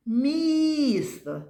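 МІСТО with a high, drawn-out МІ; calling out the word. Shown as a demonstration. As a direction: call МІСТО out, holding the stressed І. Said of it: "давайте погукаємо це слово".

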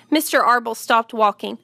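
plain reading voice

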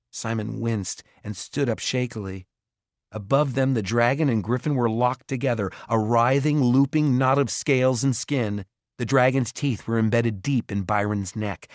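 plain reading voice

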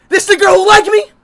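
high, loud thrilled question; real excited yelp; excited disbelief. Real, not acted. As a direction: squeal it out, can't believe it. Sees matches, thrilled.

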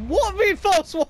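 high, slow "What" in disbelief; baffled, drawn-out question; incredulous. Real, not acted. Confused outburst at teammate.

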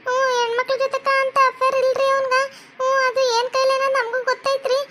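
protesting sister. Acted. Sister defends her brother to the doctor, protesting.

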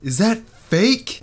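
high, slow fake doubt; mock suspicion. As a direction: drag it out like a clickbait title.

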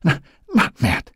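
high, halting whimper. A narrator's reading. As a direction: stammer it out in a small, high voice. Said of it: "she whimpered".

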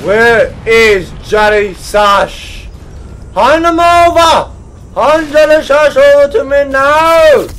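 slow, high, loud villainous demand; hammed-up demanding shout. Player demanding Johnny Sash be handed over, mock-furious shout. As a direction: bellow each word like a mock tyrant.

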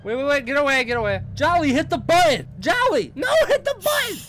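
high, loud desperate pleading screams; frantic shouting. Real, not acted. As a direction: yell begging for help. Bird chasing, begging the drone.

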